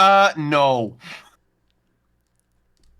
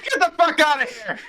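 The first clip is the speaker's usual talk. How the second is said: mock outrage; 12 semitones higher than usual.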